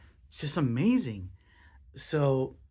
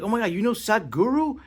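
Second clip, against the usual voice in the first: higher, louder gush of amazement; starstruck excitement; 7 semitones higher, 5 dB louder than usual. Retold or imitated. Telling the room about a friend's famous contacts, excited gush. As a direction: gush like you just spotted a celebrity. Retells own starstruck reaction.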